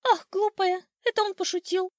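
high, loud bird scoff; dismissive old sparrow. Acted. Sparrow dismissing his wife's worry, high scoffing. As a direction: brush it off in a high chirp.

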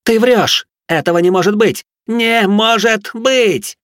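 screaming in shrill panic; hysterical shout. Acted. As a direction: break into a desperate scream. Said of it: "сорвалась на крик".

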